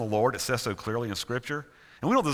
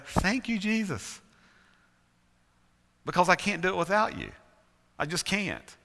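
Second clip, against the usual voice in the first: grateful prayer; 7 semitones higher than usual. Retold or imitated. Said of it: "Makes me go".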